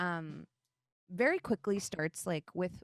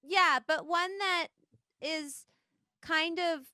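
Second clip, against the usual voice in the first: higher-pitched goddess voice; Ahavara; 6 semitones higher than usual. Acted. Turtle goddess excusing a wayward hatchling, higher character voice. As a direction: play a goddess with careful, halting tact, pitched up. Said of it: "You hear Ahavara say".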